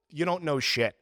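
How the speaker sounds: ordinary talk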